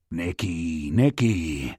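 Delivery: low, slow repeated name; calm him with his name; lightly voiced older man, low and coaxing; low soothing mentor. A narrator's reading. Waller soothing Bryson.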